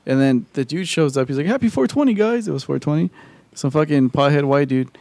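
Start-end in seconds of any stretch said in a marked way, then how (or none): 1.5-2.5 high-pitched, upbeat hail; mock cheery greeting. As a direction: greet the gang like an easygoing stoner. Acted cheerful greeting from arriving buddy.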